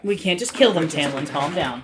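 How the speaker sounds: loud, firm calming; loud reassurance. Real, not acted. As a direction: shout him down calmly. Voicing over cutscene dialogue.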